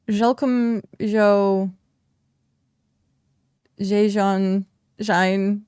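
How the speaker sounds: slow French accent reading the names. Shown as a demonstration. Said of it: "the accent is not exactly there".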